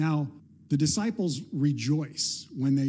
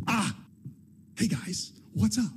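a yelp of fright then a casual "hey guys"; mock startled cry; 5 semitones higher than usual. Jesus appearing to the locked-in disciples, startled yelp. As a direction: jump in fright, then play it cool. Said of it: "Okay, no scream this time".

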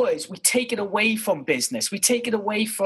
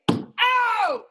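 mock yelp of pain. Host voicing his co-host getting hit, mock high yelp. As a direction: cry out like you just got whacked. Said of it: "what a noise Josh makes when you hit him".

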